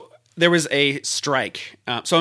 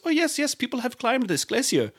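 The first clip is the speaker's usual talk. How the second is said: high, mild mock Icelandic voice; lilting imitation of the Icelandic guide; Icelandic guide's accent; 10 semitones higher, 3 dB quieter than usual. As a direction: answer like an agreeable Icelandic guide, pitched up. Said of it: "He goes, he goes".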